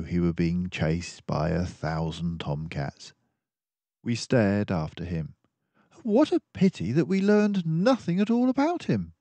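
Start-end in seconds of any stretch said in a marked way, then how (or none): 6.0-9.1 much higher, louder earnest companion; a friendly doctor mouse musing aloud; Dawson the narrator mouse. Acted.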